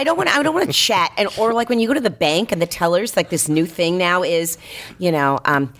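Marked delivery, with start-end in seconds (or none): 0.0-2.1 high, exasperated whine; higher, fed-up protest. Real, not acted. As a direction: push it up high in frustration. Exasperated complaint about chatty reps.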